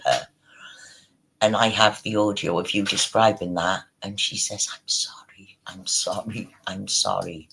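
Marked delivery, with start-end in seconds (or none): 4.7-7.5 soft, mildly imitated crying apology; retold tearful apology. Retelling her apology at the hospital, mock tearful. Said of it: "and she's crying".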